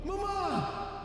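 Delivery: very high, faint creature shrieks; soft, piercingly high frantic cries; screaming chest creatures. A narrator's reading. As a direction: cry out high, as if in the background. Chests crying out behind narration.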